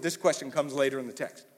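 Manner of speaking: ordinary talk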